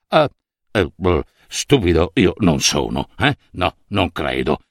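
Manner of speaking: low, hesitant inner voice with nervous "eh"s; worried official muttering. Acted. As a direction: mumble it as the uneasy official would to himself. Official thinks to himself, worried. (funzionario che borbotta tra sé, preoccupato)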